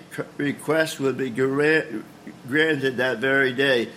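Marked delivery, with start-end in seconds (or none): none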